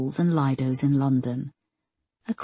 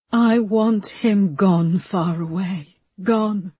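slow start, rising higher on each gone; building dark fury; 5 semitones higher, 4 dB louder, much slower than usual. A narrator's reading. Linda tells Peterson she wants David gone, rising to a cry. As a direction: climb from menace to a cry.

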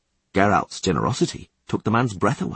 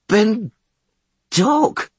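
very high, strangled cry; in pain; 13 semitones higher, 5 dB louder than usual. Acted. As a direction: wail it out in agony. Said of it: "paroxysm of pain".

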